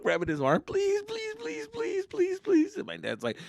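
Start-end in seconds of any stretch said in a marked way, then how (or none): 0.6-2.8 high, breathless pleading that fades softer; desperate begging. Retold or imitated. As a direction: beg over and over in panic. Begging dad repeatedly.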